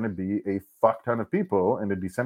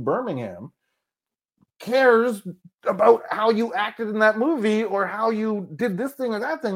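higher, incredulous voice of a friend; a hint of a mocking buddy, pitched up; lightly voiced friend scoffing; 10 semitones higher than usual. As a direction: friends scoffing at caring about internet trolls, higher and incredulous. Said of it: "They're like".

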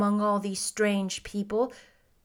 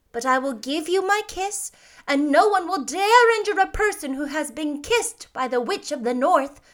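put-on high, emphatic old lady voice; kindly old witch; 7 semitones higher, 4 dB louder than usual. A narrator's reading. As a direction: advise the child firmly, pitched up.

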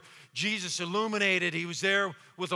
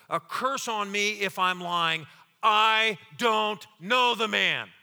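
slow, forceful oath building louder; Peter; 2 semitones higher, 5 dB louder, slower than usual. Acted. Peter swearing to bystanders, slow, vehement denial. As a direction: swear it word by word, hammering each one. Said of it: "And Peter swore".

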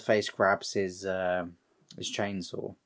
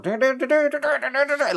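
high, repetitive machine-straining imitation; hydraulic press noise; 13 semitones higher than usual. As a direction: voice a press pushing and backing up. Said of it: "the hydraulic press, like, backing up".

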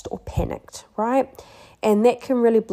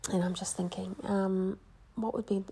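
softer, breathy whispered thinking aloud; whisper to self; 10 dB quieter than usual. Real, not acted. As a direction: whisper it as if pondering alone. Thinking to herself mid-lesson.

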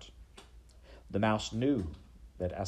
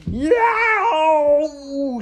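high, loud howl of pain; screamed yowl; 15 dB louder than usual. Acted. Rat Roscuro cries out as he is hurt, screamed. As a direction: let out a piercing, drawn-out yowl. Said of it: "screamed Roscuro".

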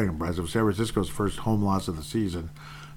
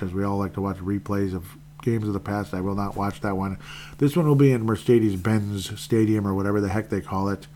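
normal talking voice

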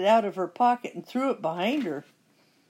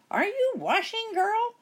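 high, louder witch calling; impatient witch call; 3 dB louder than usual. A narrator's reading. Witch calling through the door again, high and impatient. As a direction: call out, losing patience. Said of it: "Bony Legs called again through the door".

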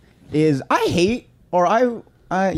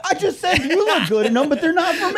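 exasperated mock protest; 13 semitones higher than usual. Acted protest at being called hater.

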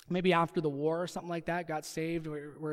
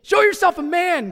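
much higher, much louder coach bark; barking gym coach; 10 semitones higher, 12 dB louder than usual. Shown as a demonstration. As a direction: yell it at the team like a coach. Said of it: "Sound like a football coach again".

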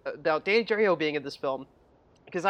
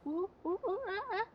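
high, soft monkey hoots; monkey chatter; 11 dB quieter than usual. Retold or imitated. Imitating Boots answering Dora, soft high hoots. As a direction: hoot softly like a little monkey. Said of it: "it's just like".

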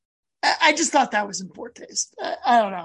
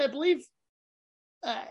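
ordinary delivery

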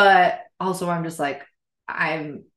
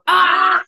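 loud, high outburst; real cry at a slip-up; frustrated yell; 10 semitones higher, 10 dB louder than usual. Real, not acted. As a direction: let out a loud aah. Frustrated at saying the name.

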